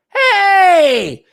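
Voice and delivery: high, loud delighted hey; excited shout. Real, not acted. Streamer excitedly shouting to an old buddy in chat.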